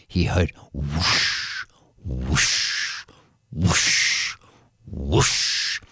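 breathy whoosh sounds, fading to a whisper; whooshing wings. A narrator's reading. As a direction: blow each whoosh like wingbeats. Wind of dragon wings.

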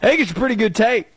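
usual speaking voice

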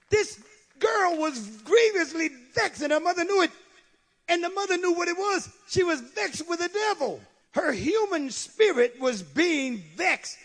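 high, loud declaration; full-force preaching. Real, not acted. Preacher on the vexed girl, full-force preaching. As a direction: proclaim it high and hard to the congregation.

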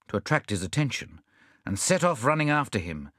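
plain reading voice

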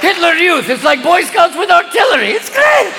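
giddy high cardinal talking over himself; stoned cardinals. Retold or imitated. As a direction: pitch a crazy idea while high and giggly.

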